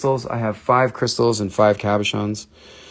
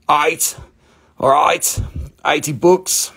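higher-pitched put-on Australian accent; playful Australian accent, pitched up; 4 semitones higher, slower than usual. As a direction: call it out like a mock Aussie. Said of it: "Where's all my Australian friends?".

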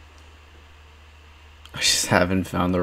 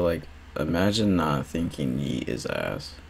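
usual speaking voice

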